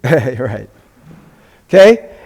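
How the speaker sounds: ordinary talk, nothing marked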